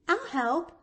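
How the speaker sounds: put-on high, eager little-girl offer; prompt promise. A narrator's reading. Pollyanna offers help with dishes, high and prompt. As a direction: jump in to offer at once. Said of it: "promised Pollyanna promptly".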